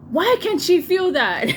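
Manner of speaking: high loud slow alarmed demand; doctor yelling. Retold or imitated. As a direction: bark it at the nurses. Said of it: "He's yelling at the nurses".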